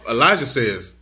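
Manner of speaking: ordinary talk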